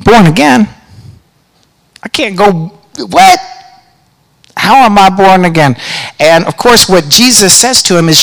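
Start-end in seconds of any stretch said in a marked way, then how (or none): 0.0-5.8 puzzled. Retold or imitated. Nicodemus confused by Jesus.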